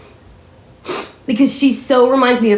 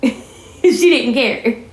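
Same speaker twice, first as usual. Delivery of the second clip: laughing through the words; slow, chuckled remark; amused chuckle; 3 semitones higher, much slower than usual. Real, not acted. Chuckles while recalling Granny.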